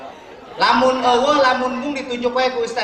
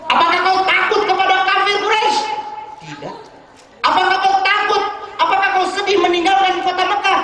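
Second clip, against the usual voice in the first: high, loud, urgent questions of the Prophet; concerned questioning prophet; 11 semitones higher, 4 dB louder, faster than usual. Acted. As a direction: press him with worried questions. Prophet questions Abu Bakar in story. (Rasul bertanya cemas kepada Abu Bakar, tinggi dan keras)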